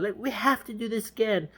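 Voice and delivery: soft, high falsetto; hushed put-on woman's voice; mock sleepy girl. Sleepy girl to James before her nap, mock falsetto. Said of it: "getting all sleepy".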